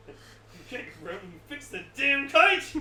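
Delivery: loud angry shout back; angry retort. Retold or imitated. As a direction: snap back at the landlord. Retort to landlord.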